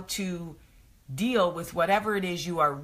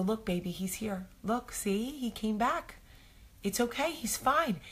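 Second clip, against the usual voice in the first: comforting; 6 semitones higher than usual. Retold or imitated. Comforting upset daughter.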